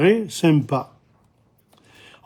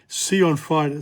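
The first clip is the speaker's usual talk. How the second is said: ordinary talk, nothing marked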